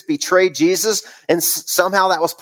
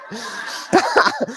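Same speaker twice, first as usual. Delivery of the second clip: high giggle; 4 dB quieter than usual. Real, not acted. Laughs at own baby talk.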